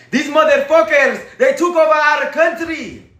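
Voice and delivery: high, loud mock Colombian accent; indignant Colombian impression, accent stretched out; imitated Colombian accent. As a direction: put on a heavy Spanish-speaker accent. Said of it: "They might say it like that".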